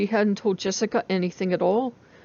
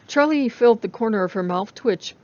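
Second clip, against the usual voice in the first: ordinary delivery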